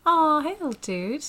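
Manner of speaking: high, slow, tender coo. Real, not acted. Cooing at the baby on monitor.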